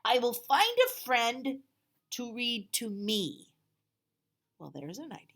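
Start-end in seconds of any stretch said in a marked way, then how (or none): 0.0-3.5 slow, high and loud dragon voice; Duncan decided. Acted. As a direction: say it with firm hopeful resolve. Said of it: "said Duncan".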